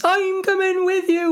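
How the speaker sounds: piercingly high falsetto; insistent Sam. Acted. Sam wades after Frodo, squealing falsetto. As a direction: squeal it stubbornly.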